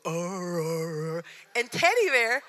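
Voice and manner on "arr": mock dog howl, lightly voiced; collie's answering howl. Honey Bear the collie howling back: light mock howl. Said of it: "Honey Bear, the female, would go".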